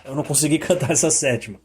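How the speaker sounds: ordinary talk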